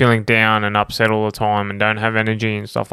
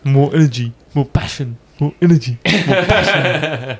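hammed-up passionate voice; 3 semitones higher than usual. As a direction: drag it out like a movie narrator. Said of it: "less deep voice, but more".

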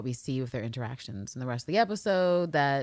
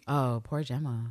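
lower, softer, slow fake pity; fake sympathy; 5 semitones lower, 4 dB quieter, slower than usual. Killer correcting herself, mock solemn pity. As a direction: put on a hollow sorry tone. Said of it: "I mean".

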